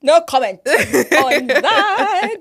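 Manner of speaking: loud, slow laugh through the words; laughing refusal. Real, not acted. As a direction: laugh it off loudly.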